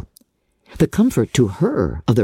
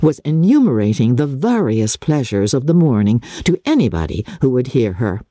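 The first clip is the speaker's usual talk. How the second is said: ordinary delivery, nothing marked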